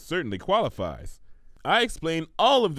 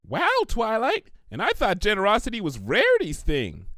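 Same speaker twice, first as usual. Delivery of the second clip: awed wow; 8 semitones higher than usual. Acted. Pinkie admires Twilight's generosity, awed. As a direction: marvel at her.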